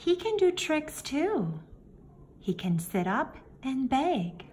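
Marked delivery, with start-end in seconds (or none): none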